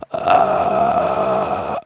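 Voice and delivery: low unvoiced throat rumble. Shown as a demonstration. Coach showing where the rumble sits. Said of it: "I feel the rumble".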